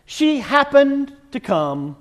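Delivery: slow, drawn out with weight on She and happened; emphatic reread. Shown as a demonstration. Preacher rereads verse to congregation, slow and emphatic. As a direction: savor each word to make the point. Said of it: "Did you catch that".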